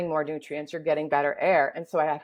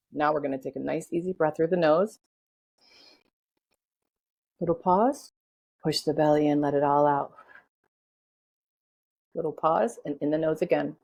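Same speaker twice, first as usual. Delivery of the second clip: guided breathing. Acted.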